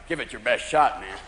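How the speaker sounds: low, unimpressed cat voice; tough cat. Acted. Cat to its stoned owner, low cocky challenge. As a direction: dare them in a deep, cool voice. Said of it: "he's looking at you like".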